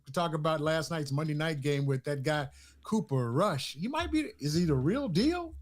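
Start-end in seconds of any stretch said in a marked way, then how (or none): none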